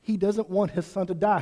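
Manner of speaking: ordinary talk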